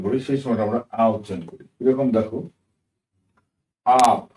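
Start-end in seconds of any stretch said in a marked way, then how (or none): 3.8-4.2 "আপ" with a long open aa; drawn-out a. Shown as a demonstration. Teacher showing a drawn-out u-as-a, long vowel in "আপ". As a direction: stretch the vowel in "আপ", loud and clear. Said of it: "এই আটাকে আমরা টেনে উচ্চারণ করেছি".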